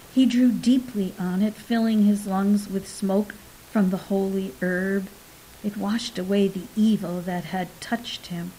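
plain reading voice

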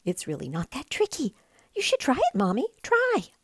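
very high, eager little chick. Acted. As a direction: brag sweetly to his mother. Chick voice showing off flying.

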